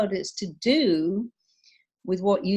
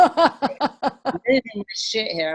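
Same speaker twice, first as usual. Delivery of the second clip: slowed, louder, higher mock panic through a laugh; panicky performer; 4 semitones higher, 6 dB louder, slower than usual. Telling a friend backstage, a hammed-up, laughing mock panic. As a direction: ham up a friend losing it before going on stage. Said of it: "That is a terrible thing to say when you go".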